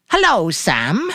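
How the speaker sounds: high, slow, forced cheer; bright rehearsal voice. A narrator's reading. Mary rehearsing a greeting to the grocer, brightly forced. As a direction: practise sounding carefree. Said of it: "she said brightly".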